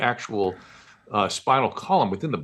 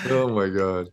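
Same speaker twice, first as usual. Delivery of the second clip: ordinary delivery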